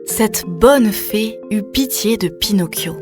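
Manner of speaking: ordinary delivery, nothing marked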